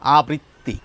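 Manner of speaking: আবৃত্তি in the careful standard form; trainer's standard form; formal pronunciation. Shown as a demonstration. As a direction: give আবৃত্তি its prescribed standard sound. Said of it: "এই শব্দটির উচ্চারণ হচ্ছে এটি".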